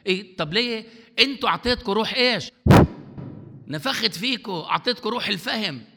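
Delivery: loud, rising sermon cry, pressing the crowd; fiery pulpit preaching; preaching at full force. Acted. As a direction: preach it hard to the congregation.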